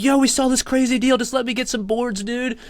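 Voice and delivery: high-pitched whiny bro voice; pushy bro customer. Retold or imitated. Customer outside the locked store begging, high bro voice. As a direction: beg to get in, way up high. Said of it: "they're like".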